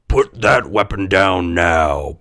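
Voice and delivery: slow, commanding gruff voice; stern, drawn-out police order; booming gruff policeman. A narrator's reading. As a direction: boom it out like a policeman. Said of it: "boomed a gruff policeman".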